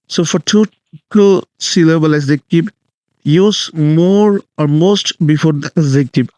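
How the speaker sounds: spelling the rule out very slowly; slow, deliberate dictation of a grammar rule; slow rule read. Shown as a demonstration. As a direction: dictate it word by word so students can copy. Teacher reads rule slowly.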